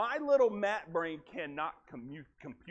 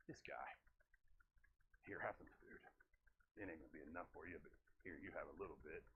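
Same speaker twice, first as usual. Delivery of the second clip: muttering low and hushed, stingy with the food; hushed grumble; 19 dB quieter, faster than usual. Acted. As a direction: grudgingly mutter it under your breath. Disciple Matthew grumbling handing food.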